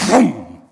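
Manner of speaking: a loud, mock slam sound for the sign; slamming noise. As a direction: bang it down like Thor's hammer. Lady slams stop sign down.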